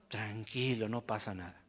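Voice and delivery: low, hushed soothing aside; mock reassurance. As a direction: drop right down to soothe, in mock leniency. Finge tranquilizar al Chapo perdonado. (consuelo fingido, bajito y grave)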